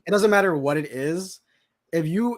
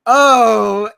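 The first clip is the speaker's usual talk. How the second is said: loud drawn-out oh, climbing higher on the second; dawning shock; 5 semitones higher, 11 dB louder than usual. Retold or imitated. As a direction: let the plot twist hit you, louder each time. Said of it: "it's like".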